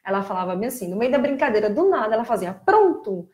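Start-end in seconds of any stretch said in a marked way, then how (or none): 2.5-3.3 high, loud cartoon voice copied by a child; bright cartoon echo, pitched up and loud; imitating Dora the Explorer. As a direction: sing it out like a cheery cartoon host. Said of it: "Ela repetia exatamente igual a Dora".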